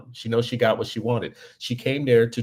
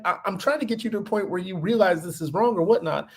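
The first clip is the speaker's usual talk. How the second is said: retold incredulity; 9 semitones higher than usual. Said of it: "he's getting, like, incredulous".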